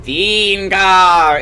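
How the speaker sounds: droning ghost moan; ghostly moan. Acted. Ghost rising from grave, moaning its name. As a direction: moan the name again, dead and hollow. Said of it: "it moaned again".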